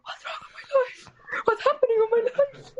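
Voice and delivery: high, cracking wail of despair. Retold or imitated. Prisoner wailing in the police jeep, high and cracking. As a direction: cry out at your own life, voice breaking up.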